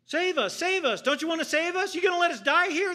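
high, loud panicked pleading; terrified pleading. Retold or imitated. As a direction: beg for rescue as the boat sinks. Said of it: "They kept repeating themselves".

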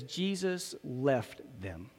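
slow, low and hushed, weighing each word; saddened. Real, not acted. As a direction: let the sad phrase land slowly and softly. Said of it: "my heart was sad".

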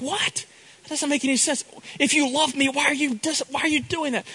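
put-on high, bewildered little-kid voice; confused child. Boy asks mom why love means spanking, in a high bewildered child voice. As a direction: play the puzzled boy asking why.

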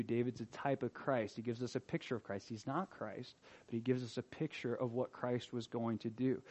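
hushed reflection. Real, not acted. Preacher closing the teaching to the church, hushed. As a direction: draw them in close and quiet.

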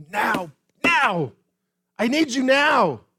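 loud, rising cry of a prayer; desperate pleading. Acted. Preacher acts out pleading with God, desperate loud cry. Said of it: "Have you ever pleaded with God to act?".

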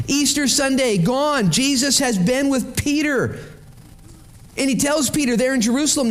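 high-pitched, driving sermon delivery; impassioned preaching. Acted. Preacher recounting Peter quitting after the resurrection, high and impassioned. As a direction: proclaim it with rising urgency.

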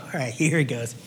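setting up the swing, low and light; mock batter. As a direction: pastor acts out God about to swing, low playful. God about to swing bat.

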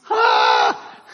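loud high shout of Ah; sharp exclamation. Real, not acted. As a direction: burst out with a high cry. Exclaiming mid-sermon.

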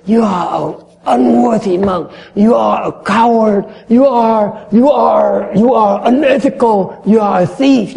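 high, loud mock accusation; hammed-up angry accuser hurling insults. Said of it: "they say".

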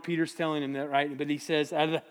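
ordinary delivery

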